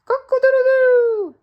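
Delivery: high, loud, slow rooster crow. Acted. Storyteller crowing back as the rooster shouts. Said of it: "shouted the rooster".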